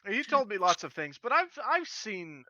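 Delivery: a hint of the strangled British voice; lightly voiced high British man; British Teddy voice. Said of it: "to do a British noir Teddy".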